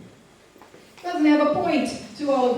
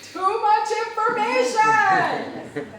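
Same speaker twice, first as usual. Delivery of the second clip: high, slow mock scream of overload; 12 semitones higher, 5 dB louder, much slower than usual. The audience's overwhelmed brains screaming, a high slow mock scream. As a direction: wail it like a brain about to burst. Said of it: "the audience's brains are screaming".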